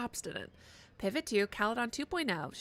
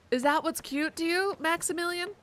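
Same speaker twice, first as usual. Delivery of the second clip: higher, louder mock indignation; indignant; 7 semitones higher, 4 dB louder than usual. Real, not acted. Player confronting Maximilian in mock indignation. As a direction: demand an answer.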